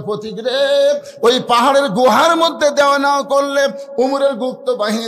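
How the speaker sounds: loud, high declaimed retort; high, forceful acted reproach; heated retort. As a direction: call him out at full voice. Shepherd retorting to traveller, acted.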